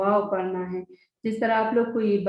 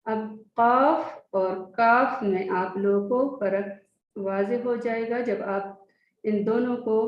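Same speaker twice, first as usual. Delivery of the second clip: ordinary talk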